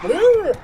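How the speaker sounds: sudden high, loud whoop. Retold or imitated. Roommates tossed around in the speeding car, high whoop. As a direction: let out a whoop as you slide.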